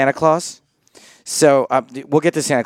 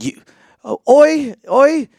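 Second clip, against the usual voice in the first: much higher, louder mock English "oi"; English accent; 13 semitones higher, 5 dB louder, slower than usual. Poker player imitates an English stranger calling out in a bad English accent. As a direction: yelp "oi" like an angry English bloke, in a bad English accent. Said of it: "I can't do an English accent".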